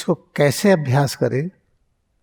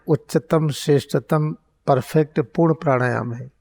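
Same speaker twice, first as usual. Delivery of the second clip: ordinary delivery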